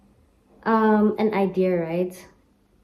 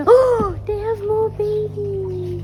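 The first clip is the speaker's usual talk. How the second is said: excited squeal; 9 semitones higher, slower than usual. Real, not acted. Excited at baby ice cream treats.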